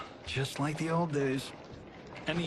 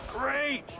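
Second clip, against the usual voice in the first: ordinary talk, nothing marked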